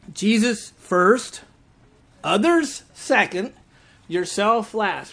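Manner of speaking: each phrase drawn out slowly; slow emphasis. Shown as a demonstration. Preacher teaching the congregation JOY, slow and deliberate. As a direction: spell it out deliberately, word by word. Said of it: "spelling out JOY slowly".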